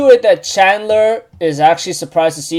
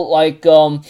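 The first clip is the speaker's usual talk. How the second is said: normal talking voice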